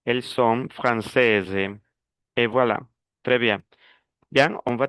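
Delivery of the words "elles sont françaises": slow model of "Elles sont françaises", ending in a clear "-èze"; feminine ending sounded. Shown as a demonstration. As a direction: let the Z sound out at the end of "françaises". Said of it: "Ahí sí le agrego la S". ("Elles sont françaises" despacio, con la terminación sonora)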